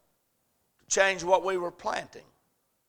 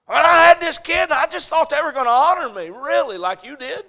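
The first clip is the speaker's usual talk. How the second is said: loud, higher complaint of a let-down parent; mock entitled parent; 10 semitones higher, 11 dB louder than usual. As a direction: play the wounded parent, loud and high.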